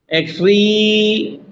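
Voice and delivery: एक्सट्री with "tree" held long and level; stress on TREE. Shown as a demonstration. Teacher comparing where force falls, एक्सट्री stretched on "tree". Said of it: "अब से ज्यादा जोर क्या?".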